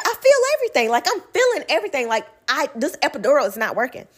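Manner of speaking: retold frantic insistence, climbing high then easing down; alarmed protest. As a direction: pitch jumps way up, then settles, fast. Retold panicked protest to doctor.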